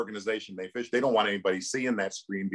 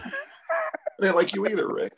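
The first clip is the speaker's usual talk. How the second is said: high, laughing comeback; mock retort breaking into laughter; 9 semitones higher than usual. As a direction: fire it back through laughter. Laughing retort in a retold exchange.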